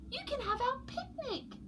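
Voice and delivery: high, soft plea; pleading chicks. A narrator's reading. Chicks beg polar bear to stay, high. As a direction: beg him sweetly. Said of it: "said Pog".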